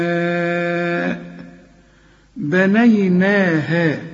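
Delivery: slow chanted والسماء بنيناها with long madd; Quran recitation. Shown as a demonstration. Tajweed teacher models the verse, chanted slowly. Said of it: "تقول".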